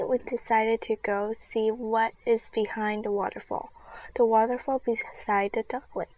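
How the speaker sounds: plain reading voice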